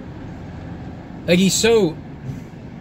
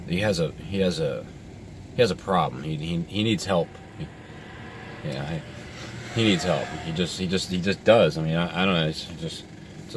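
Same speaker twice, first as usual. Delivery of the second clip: much softer, dropping the voice to a mumble; low muttering; 10 semitones lower, 9 dB quieter than usual. Real, not acted. Muttering to the viewers about the doom guy, much softer. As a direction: mumble it half to yourself, trailing away.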